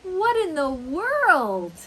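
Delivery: loud, high, drawn-out amazement; happy surprise. Acted. Guest of honor at a surprise party, loudly amazed. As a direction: cry out, astonished and delighted. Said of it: "could be a happy surprise".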